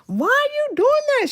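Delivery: high, squealed mock protest; exasperated mimicry. Mimicking the woman with the gun in the bank, high exasperated squeal.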